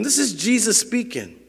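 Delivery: ordinary delivery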